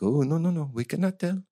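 lightly put-on French waitress accent; mock French accent. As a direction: imitate a snooty French server. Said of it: "working on this French accent".

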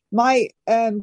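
usual speaking voice

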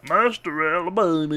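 put-on southern accent. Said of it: "can't really do a southern accent".

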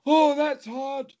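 drawn-out repeated lament. Retold or imitated. Woman waiting outside for Geraldine, slow drawn-out moan. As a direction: drag out each word. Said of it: "she just kept saying over and over".

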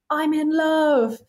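high, drawn-out swoon; mock gushing. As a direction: imitate someone head over heels, slowly. Mock gushing reaction to one's cast.

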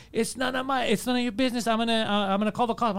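high-pitched mock protest, no real volume; retold yelling. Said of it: "So he starts yelling".

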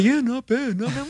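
mocking Rel's lukewarm review in a put-on voice; unimpressed Rel. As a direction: act like it's merely okay. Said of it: "And Rel's just like".

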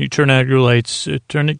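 ordinary talk, nothing marked

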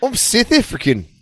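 high, mock South African accent; fake South African accent. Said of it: "trying to put on a South African accent".

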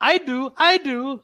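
high, eager little voice; hammed-up high eager yelp; mock eager voice. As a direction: beg for it in a high voice. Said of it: "the man is like".

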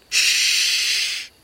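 sharp voiceless shush; urgent shush. A narrator's reading. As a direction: hush him fast before danger hears. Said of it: "tell Blinn to be quiet".

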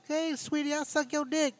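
high, sugary mock come-on, way up in pitch; mock hooker voice. Imitating a hooker calling to a driver, high mock come-on. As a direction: camp it up like a hooker flirting. Mocking hooker talk in cop car.

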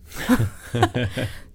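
kort, förvånat skratt åt minnet. Real, not acted. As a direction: skratta till åt hur galet det var. Minns det absurda erbjudandet. (ett kort skratt av förvåning)

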